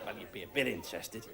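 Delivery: low, soft, quick concession; understated aside. Acted. Comic conceding after the giant turtle line, understated. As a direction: drop right down and play it down.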